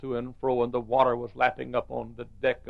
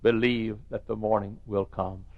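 normal talking voice

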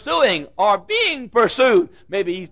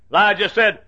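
ordinary delivery

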